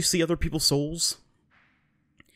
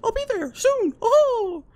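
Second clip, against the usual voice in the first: very high cartoon mouse falsetto; Mickey Mouse; 5 dB louder, slower than usual. Mouse king calling out to lost friend, squeaky falsetto impression. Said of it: "Mickey Mouse".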